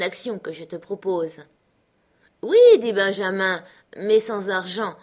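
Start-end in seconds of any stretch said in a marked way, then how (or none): 2.4-2.6 loud, drawn-up burst on the word; a hearty, emphatic agreement; emphatic yes. A narrator's reading. Benjamin answering his friend's offer of wine, emphatic yes.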